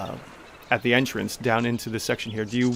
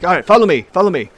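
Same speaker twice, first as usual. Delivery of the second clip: higher, louder, brisk call; Tamili leading; 6 semitones higher, 8 dB louder, faster than usual. Acted. Halfling owner leading the party to the back room, brisk call. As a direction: wave them along. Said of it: "She's like".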